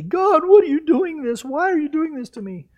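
high, loud anguished question, fading; frightened complaint to God; dismayed cry. Retold or imitated. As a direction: plead at God in alarm. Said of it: "my first response would be".

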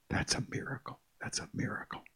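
hushed, breathy whisper of awe. Real, not acted. Preacher hushing the congregation, whispering in awe. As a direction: drop to a whisper to let it sink in.

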